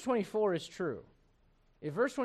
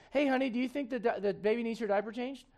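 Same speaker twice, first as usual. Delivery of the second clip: higher-pitched imitation of a wife; hinting wife; 5 semitones higher than usual. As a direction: lilt it up like a wife dropping a hint. Voices a wife hinting to husband.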